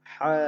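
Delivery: a gentle, polished হা; cleaner soft ha. Shown as a demonstration. Teacher showing students a nicer হা. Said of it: "আরো সুন্দর করে হা".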